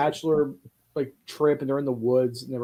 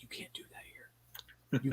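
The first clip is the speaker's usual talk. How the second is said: slow hushed whisper from mom; whispered warning; 16 dB quieter, slower than usual. Retold or imitated. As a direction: hiss it so no one overhears. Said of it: "She's like".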